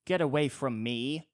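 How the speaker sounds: mock old woman snapping at strangers; cranky old woman. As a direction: shoo them off like a cranky old lady. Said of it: "some old woman like".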